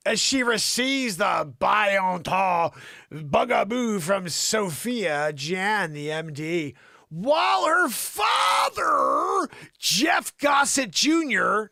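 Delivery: loud, slow, over-the-top news read; booming mock-news voice; mock newscaster shout. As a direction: bellow the headline like a hammy anchor.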